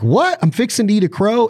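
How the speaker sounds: high-pitched, incredulous outburst; mock disbelief. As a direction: act out being thrown a curveball. Imagined shocked reaction to food.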